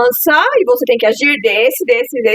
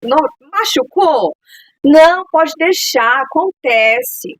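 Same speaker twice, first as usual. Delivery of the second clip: high, drawn-out reassuring hostess voice; gracious hostess; 5 semitones higher, slower than usual. Acted. Hostess to visiting child who broke a plate, overly gracious. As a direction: sing out exaggerated reassurance to a guest. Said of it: "anfitriã".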